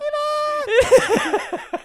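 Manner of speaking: loud squeaky falsetto, laughing; very high comic conscience, breaking into laughter; little inner voice. Retold or imitated. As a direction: squeal it way up high as the nagging echo. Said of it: "that little echo that's trying to come out".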